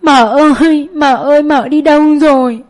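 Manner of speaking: put-on boy sobbing out for his mother; bursting into tears. A narrator's reading. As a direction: wail for the lost mother, voice breaking. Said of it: "òa khóc lên".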